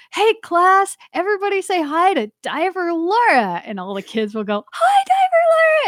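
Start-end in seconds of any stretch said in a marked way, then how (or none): 0.1-3.6 class introducer. Retold or imitated. Introducing the diver to a class of kids, imitated high rallying call. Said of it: "the way they introduce you". 4.7-5.9 very high, loud mock squeal of a chorus of kids; imitated screaming kids. Class of kids greeting the diver, mock scream. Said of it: "they're all screaming in your ear".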